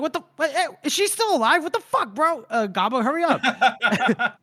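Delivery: high, incredulous outburst in imitation; mock exasperation. Acts out Orso's impatient outburst.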